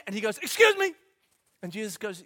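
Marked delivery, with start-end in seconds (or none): 0.4-0.9 high, loud butting-in; man in the crowd butting in; pushy interruption. Retold or imitated. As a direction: cut in like an impatient heckler.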